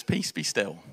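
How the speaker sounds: low, soft and composed; calm command. Retold or imitated. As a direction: settle the storm calmly. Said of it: "he really calmly says".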